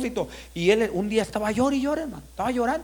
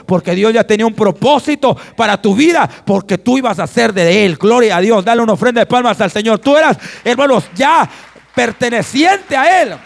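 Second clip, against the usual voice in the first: loud, rising exhortation to the congregation; full-force preaching; 3 semitones higher, 11 dB louder than usual. Real, not acted. Pastor telling believers God had a purpose for them, fervent loud preaching.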